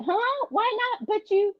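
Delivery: high-pitched mock bewilderment; retold puzzlement. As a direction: imitate a taken-aback maid, pitched high. Maid's puzzled reaction, retold.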